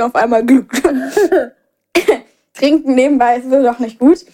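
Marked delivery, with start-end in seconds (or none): 0.0-0.8 laughing. Real, not acted. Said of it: "ich hab mich so verschluckt".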